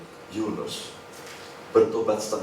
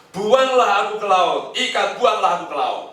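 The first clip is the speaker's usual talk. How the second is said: Jonah pleading; 8 semitones higher, 5 dB louder than usual. Retold or imitated. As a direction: throw out his plea like a guilty prophet. Jonah begs to be thrown overboard.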